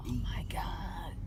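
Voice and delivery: breathless hushed whisper, slowed; awed whisper. Real, not acted. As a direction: whisper it in amazement. Awed at finding Deke.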